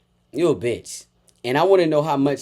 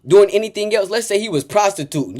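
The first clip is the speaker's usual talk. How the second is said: ordinary delivery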